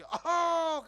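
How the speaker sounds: loud high hack; one big hack at the in-laws' house; throat clearing. Retold or imitated. Uncle hacking at the window, loud. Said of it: "ઓલું ફાર્યું હતું".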